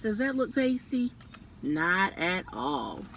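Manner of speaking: ordinary delivery, nothing marked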